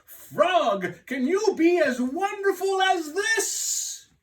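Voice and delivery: high, loud call; boastful cry. Acted. Toad boasting to Frog from the stage, loud call. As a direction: show off to your friend. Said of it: "said Toad".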